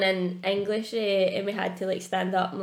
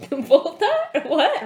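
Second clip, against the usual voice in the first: mock disbelief; 8 semitones higher, 6 dB louder than usual. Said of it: "I was like".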